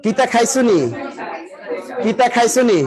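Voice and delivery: put-on Mymensingh question; Mymensingh dialect. Shown as a demonstration. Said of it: "ময়মনসিংহ?".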